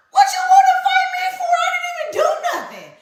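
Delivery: high, fast, hammed-up protest that climbs into a shout; mock indignant shout. Retold quarrel on the lawn.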